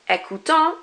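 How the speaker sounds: écoutant with the nasal ending; French pronunciation. Shown as a demonstration. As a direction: say écoutant with the final ant. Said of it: "So instead of".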